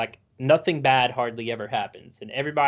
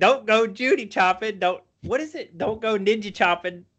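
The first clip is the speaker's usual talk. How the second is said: mock movie line; 7 semitones higher than usual. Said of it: "Or it's like".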